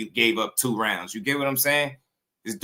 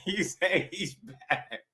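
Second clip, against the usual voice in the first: high, slow and softer through laughter; too amused to say it straight; laughing; 7 semitones higher, 6 dB quieter, slower than usual. Real, not acted. Cracking up at the played clip.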